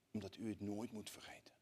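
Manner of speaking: a hushed, breathy whisper; whispered quote. Retold or imitated. Survivor's last warning to the congregation, whispered. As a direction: lean in and whisper her warning.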